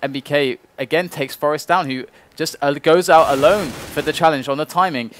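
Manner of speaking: rising, excited play-by-play; esports caster. Real, not acted. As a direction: build the call up high and loud. Live play-by-play rises to peak.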